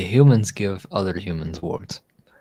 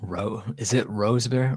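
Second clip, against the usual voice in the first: ordinary talk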